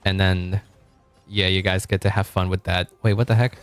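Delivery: ordinary talk, nothing marked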